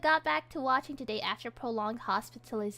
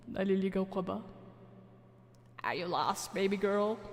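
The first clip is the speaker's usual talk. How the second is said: put-on old man voice; 3 semitones lower than usual.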